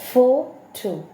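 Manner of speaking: PHOto, first syllable punched loud; stress on photo. Shown as a demonstration. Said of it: "the word photo has taken".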